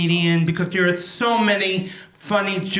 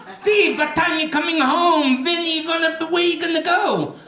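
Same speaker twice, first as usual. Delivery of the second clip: put-on much higher nagging mother with a European accent; mother's European accent; 9 semitones higher than usual. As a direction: nag like a Brooklyn mom faking a European accent. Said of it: "she died with a European accent".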